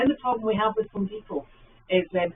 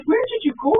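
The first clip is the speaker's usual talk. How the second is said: frustrated; 13 semitones higher, 8 dB louder than usual. Retold or imitated. Frustrated wife after phone failed.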